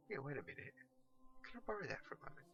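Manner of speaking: soft, quick then high eager ask; dawning realisation. Acted. Pickle notices the flower, quick eager surprise.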